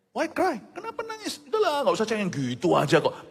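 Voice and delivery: high, mocking brush-off; dismissive scoff. Careless friend brushing off a crying widow, high-pitched. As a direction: wave her tears away carelessly.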